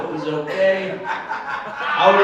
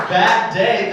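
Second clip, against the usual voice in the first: girlfriend's bad day; 4 semitones higher, 7 dB louder than usual. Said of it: "If she says".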